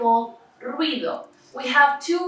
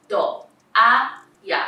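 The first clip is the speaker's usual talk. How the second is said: "To, a, ya" broken into three separate syllables; 4 dB louder than usual. Shown as a demonstration. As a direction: pause between each part of "To, a, ya". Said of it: "end up being in different syllables".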